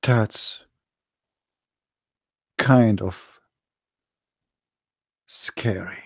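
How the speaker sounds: a hushed Halloween fright; hammed-up scared whisper; mock spooked whisper. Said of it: "Today is Halloween".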